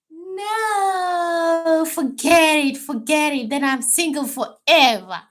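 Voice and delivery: high, loud wailing give-up; mock despair. Real, not acted. Giving up on finding a tall man, she wails high and loud in mock despair. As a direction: wail it like a hopeless case, half laughing.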